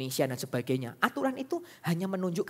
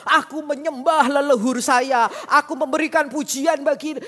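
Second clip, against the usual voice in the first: imitating mother; 10 semitones higher, 10 dB louder, slower than usual. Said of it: "mama mengatakan bahwa".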